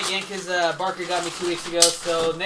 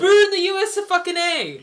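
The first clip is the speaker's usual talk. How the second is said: high, loud hammed-up chant of USA; mock patriotic shout; 13 semitones higher, 11 dB louder than usual. Mocking the Texas-brewed can with a high loud shout. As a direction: belt it out like a proud American. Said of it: "That was pretty good".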